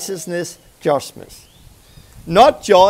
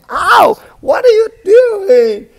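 high, loud outraged ow; angry yell; 14 semitones higher, 10 dB louder, slower than usual. Pretend. Struck man shouts at his attacker, high and loud. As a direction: yell at the man who keeps hitting you. Victim hit a third time.

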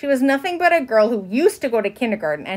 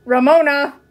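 loud, higher-pitched fatherly warning; 5 semitones higher, 7 dB louder than usual. A narrator's reading. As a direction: call the name as a sharp warning. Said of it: "voice held a warning note".

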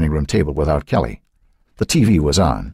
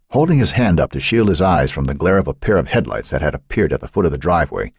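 ordinary delivery

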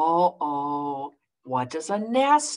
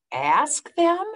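higher questioning; puzzled echo; 8 semitones higher, 4 dB louder than usual. Acted. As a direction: repeat it, baffled. Gerald puzzled.

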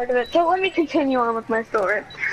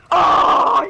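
loud, high, drawn-out cry; mock exclamation; 5 semitones higher, 7 dB louder than usual. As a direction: belt out an overdone reaction. Acting out an exaggerated chat reaction.

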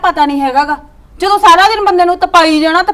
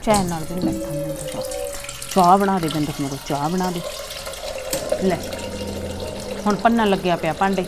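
low, much softer sour muttering; grumbling to herself; 11 semitones lower, 12 dB quieter than usual. Acted. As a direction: mutter the complaint under your breath. Wife grumbling alone after he leaves. (ਹੌਲੀ ਹੌਲੀ ਬੁੜਬੁੜਾਉਣਾ)

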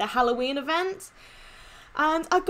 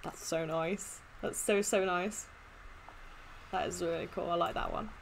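much softer and lower, quietly admiring; hushed awe; 8 semitones lower, 13 dB quieter than usual. Real, not acted. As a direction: murmur it in quiet wonder. Awed soft murmurs.

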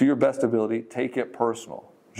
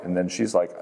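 ordinary delivery

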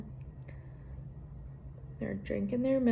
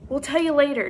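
louder call while running; shouting; 6 semitones higher, 10 dB louder, faster than usual. Acted. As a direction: shout back over the shoulder. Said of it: "Jessie shouted".

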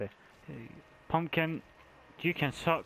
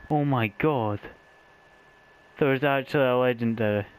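louder astonished exclaiming; amazed; 4 dB louder than usual. Real, not acted. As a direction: gasp in disbelief at loot. Finding a legendary.

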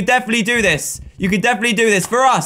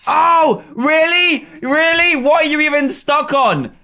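incredulous outburst; 7 semitones higher than usual. Real, not acted. Character stuck on nothing.

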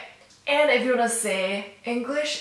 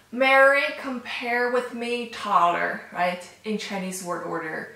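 ordinary talk, nothing marked

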